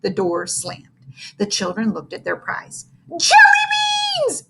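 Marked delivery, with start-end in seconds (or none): 3.1-4.4 high, slow, delighted yell; excited shout. Acted. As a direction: squeal it like a kid spotting candy. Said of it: "exclaimed Ham Sandwich".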